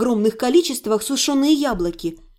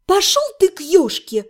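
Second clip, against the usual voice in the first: high, crisp send-off; clear, raised-pitch dismissal; distinctly enunciated; 5 semitones higher than usual. A narrator's reading. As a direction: tell him off, each word distinct. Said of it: "чётко выговорила я".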